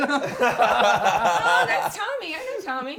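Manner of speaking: loud and high, laughing through it; delighted, laughing as you spot a friend on screen; laughing recognition. Pretend. Recognizing a friend in the passenger's porn, laughing loudly.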